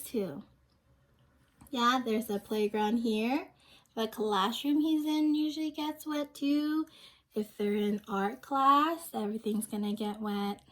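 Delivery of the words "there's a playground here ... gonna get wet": usual speaking voice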